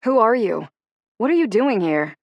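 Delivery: frightened challenge to the strangers; alarmed demand. A narrator's reading. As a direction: confront the intruders with sharp alarm. Strangers push into her house.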